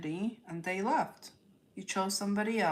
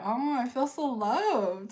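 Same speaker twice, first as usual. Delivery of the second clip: gushing thanks; 7 semitones higher, 6 dB louder than usual. Real, not acted. Thanks chat for love.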